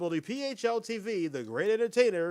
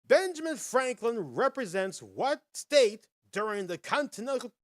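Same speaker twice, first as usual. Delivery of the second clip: usual speaking voice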